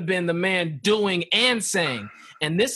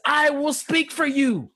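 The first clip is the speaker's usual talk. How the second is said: slow, higher, solemn promise; slow higher divine promise; voice of God; 6 semitones higher than usual. Retold or imitated. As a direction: speak it as God reassuring Moses. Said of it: "God says".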